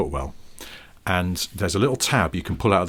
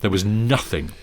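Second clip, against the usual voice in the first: ordinary delivery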